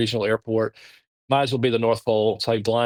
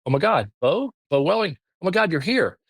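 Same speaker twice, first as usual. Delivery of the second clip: higher and rushed, thrilled at the surprise; excited; 8 semitones higher, faster than usual. Retold or imitated. Curler Pete recognising the visitor from South Carolina, excitedly. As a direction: gush with delighted disbelief, a lot higher. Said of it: "he's like".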